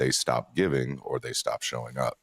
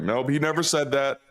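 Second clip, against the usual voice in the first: higher, lightly voiced brushing-off denial; mock denial; 10 semitones higher than usual. Imitating supporters dismissing RFK's words, lightly mocking higher denial. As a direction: wave it away like a spin doctor. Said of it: "It's like".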